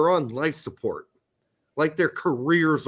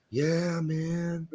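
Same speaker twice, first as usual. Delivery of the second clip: drawn-out, softer hippie drawl; 6 dB quieter than usual. Acted. Mimicking the hippie movement's talk, mellow hippie voice. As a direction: stretch it out like a hippie. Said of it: "people started talking like".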